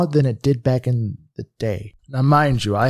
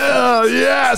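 excited shout; 10 semitones higher, 3 dB louder than usual. Acted.

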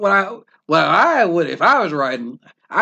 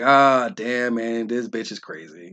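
imitating Jon; 9 semitones lower, 4 dB quieter, slower than usual. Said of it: "And Jon's like".

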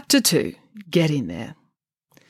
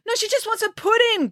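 calling out. Acted. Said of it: "called Friday".

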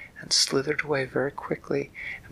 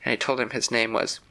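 usual speaking voice

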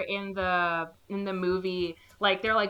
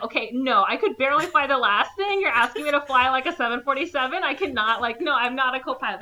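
higher, louder acted refusal; agitated protest; 5 semitones higher, 5 dB louder, faster than usual. Gordon refusing to fly the plane, agitated protest. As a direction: protest it in a raised, incredulous voice.

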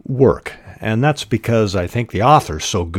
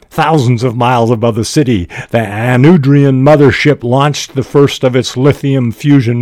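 loud, higher-pitched pulp sci-fi reading; dramatic story narration; 5 semitones higher, 7 dB louder than usual. Acted. Rival writer hijacks the story with alien war, dramatic narration. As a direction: belt out the space-war story, easing off at the end.

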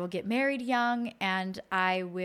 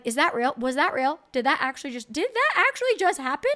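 stunned disbelief; 5 semitones higher, 5 dB louder than usual. Retold or imitated. Laughing during labour, disbelief.

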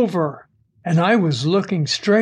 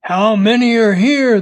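loud, higher-pitched interrogation; insistent, raised-voice question; forceful demand; 5 semitones higher, 6 dB louder than usual. A narrator's reading. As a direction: demand an answer, raising the voice. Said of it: "the man demanded again".

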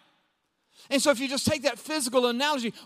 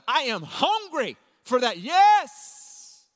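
acted eagerness; 2 semitones higher, much slower than usual. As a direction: pounce on the offer, building to an emphatic outburst. Acted eager reply to money tip.

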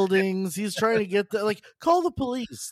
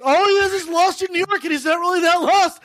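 excited rant; 8 semitones higher than usual. Real, not acted. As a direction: rant it out, mocking the plot. Ranting about the movie.

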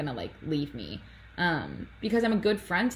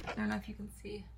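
whisper; 4 semitones higher, 11 dB quieter, much faster than usual. Real, not acted. Aside whisper about camera view.

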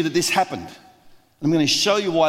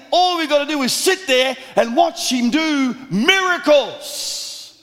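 fiery preaching; 8 semitones higher than usual. Acted. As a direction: preach it out to the whole crowd. Preacher building to full force.

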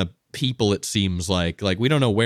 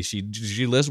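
usual speaking voice

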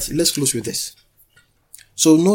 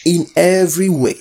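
loud, raised proclamation; call and response; 3 semitones higher, 6 dB louder than usual. Real, not acted. Preacher feeds congregation the next phrase, loud call. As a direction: call it out for them to echo. Leading congregation to repeat after him.

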